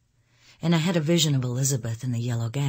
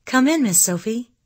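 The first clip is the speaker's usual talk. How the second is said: high, louder call with rising end; 10 semitones higher, 5 dB louder than usual. A narrator's reading. Elizabeth to Sophie passing her room, calling. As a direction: call a visitor in, pitched up. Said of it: "and she called to me".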